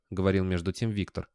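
ordinary delivery

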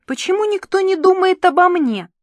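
loud, high-pitched tantrum; petulant outburst. A narrator's reading. Ниночка complains that nobody thinks of her, petulant. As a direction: protest like a stamping spoiled child. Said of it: "топнула ногой Ниночка".

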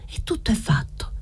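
a soft unvoiced whisper to herself. Girl whispering a resolve to herself at home, whispered. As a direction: breathe the words out as a private vow.

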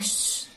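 soft drawn-out "shh"; long, low shushing hiss; hushing shh. Retold or imitated. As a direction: hush someone quietly. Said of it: "Thì bà ba kêu".

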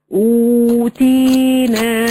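أوتينا in three long held syllables, uu-tii-naa; drawn-out long vowels. Shown as a demonstration. Third example word for students, vowels lengthened. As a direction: stretch every madd letter in أوتينا evenly. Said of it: "تحتوي على ثلاثة الحروف".

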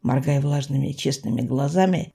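plain reading voice

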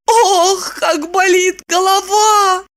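slow, high-pitched moan; pained gasp. A narrator's reading. Woman's hangover headache strikes, high pained moan. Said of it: "тут же ахнула".

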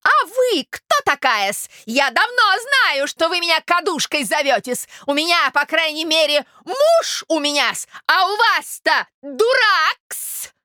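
high, slow, stinging tirade; affronted lady, furious. Acted. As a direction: hurl insults back as an outraged gossip. (взбешённая дама, высоко и с нажимом)

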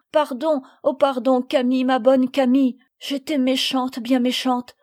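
loud, tearful begging; sobbing plea. A narrator's reading. Sophie on her knees to Camille, sobbing plea. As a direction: plead in acted sobs. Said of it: "en sanglotant".

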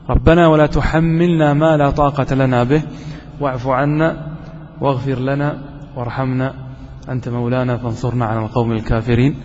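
تلاوة خاشعة بطيئة; Quran recitation. Acted.

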